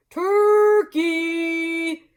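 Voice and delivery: high, loud sing-song call of a moose; sweetest calling voice. A narrator's reading. Mister Moose calls sweetly to Turkey. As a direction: call sweetly across the snow. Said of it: "called in his sweetest voice".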